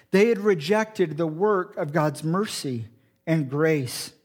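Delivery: usual speaking voice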